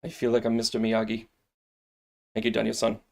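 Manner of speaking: ordinary talk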